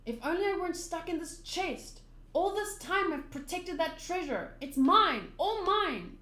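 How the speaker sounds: lightly acted, swelling into a possessive claim; lightly voiced possessive castaway. Gaimon laments the treasure out of his reach, possessive.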